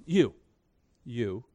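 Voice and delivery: hushed, pointed "you"; emphasized you. Shown as a demonstration. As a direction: dwell on "you" and point it straight at the listeners. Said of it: "which is emphasizing it".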